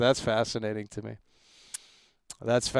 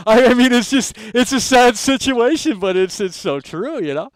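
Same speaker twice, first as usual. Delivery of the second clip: Trump impression; 9 semitones higher, 8 dB louder than usual. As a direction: ham up a Trump-style lament, slowing on sad. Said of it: "We're not doing an impersonation".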